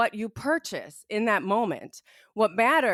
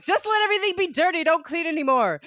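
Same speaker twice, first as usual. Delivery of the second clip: high, loose and quick mock outburst; mock exasperation; 7 semitones higher, 3 dB louder, faster than usual. Host jokes about giving up on cleaning, in mock exasperation. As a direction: throw it out high and fast, half joking. Mock plea after laughing.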